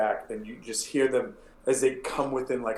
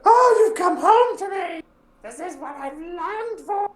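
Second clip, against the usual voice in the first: put-on much higher, loud, strange old woman cry; terrible hag voice; 9 dB louder than usual. Gnarled mother calling to her returning kids, high terrible voice. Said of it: "their voices are terrible".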